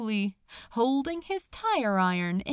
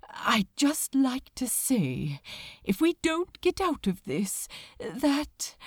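hesitant; 4 semitones higher than usual. Acted. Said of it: "he hesitated".

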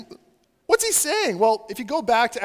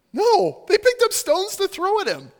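high, loud, incredulous insistence; forceful, raised-pitch protest; emphatic rebuttal; 11 semitones higher, 7 dB louder than usual. Real, not acted. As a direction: burst out to correct the cosy picture. Emphatic rebuttal of cute image.

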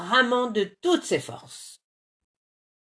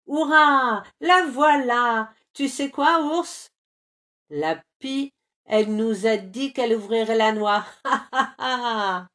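loud, higher cheer and laugh; joyful cheer; 4 semitones higher, 6 dB louder than usual. A narrator's reading. Bear swimming back cheering to the others.

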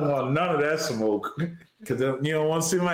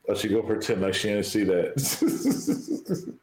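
usual speaking voice

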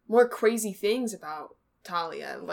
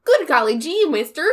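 put-on high, loud golly-gee kid voice; wholesome 1930s kid; 5 semitones higher, 9 dB louder than usual. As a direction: chirp it like an old-timey child. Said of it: "A nineteen thirties, like, young child".

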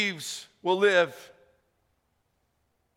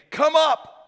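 loud, high summons; calling voice; 9 semitones higher, 7 dB louder than usual. Acted. Jesus summoning the believers to the air, in a loud call. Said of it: "voice of Jesus saying".

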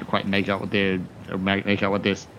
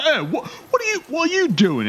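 high, raised-pitch guard challenge; startled guard. Acted. Guard challenges a man who crashed down, startled. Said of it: "and the guard's like".

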